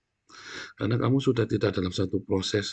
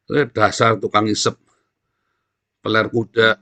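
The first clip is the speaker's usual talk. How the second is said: Jeremiah's insult; 7 dB louder than usual. Retold or imitated. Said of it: "ketika Yeremia berkata".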